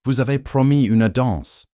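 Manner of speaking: loud, high, officious French; disguised Holmes pulling Lady Helena away; pompous French diplomat. A narrator's reading. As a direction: insist grandly on the promised dance. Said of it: "drop the accent entirely".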